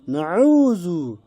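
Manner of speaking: নাউযু stretched slowly; slow Arabic pronunciation; drawn-out নাউযু. Shown as a demonstration. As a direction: hold the vowels of নাউযু. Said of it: "এই যে দেখেন".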